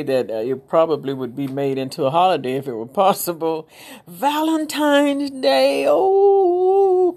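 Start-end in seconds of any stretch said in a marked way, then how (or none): 4.1-5.9 high, slow, sing-song announcement of "Valentine's Day"; excited reveal. Acted. Big reveal of the date. 5.9-7.1 high, loud teasing "oh"; mock gasp. Teasing the listeners' reaction.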